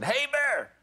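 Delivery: high-pitched "Hey, bear!" call; re-enacted bear call. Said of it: "what to do when you face a bear".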